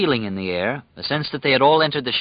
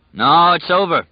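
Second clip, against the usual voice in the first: high, loud insistence; insisting; 8 semitones higher, 6 dB louder than usual. A narrator's reading. Ralph insists to the guards, loud and high. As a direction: insist it's over, pressing hard. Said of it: "Ralph insisted".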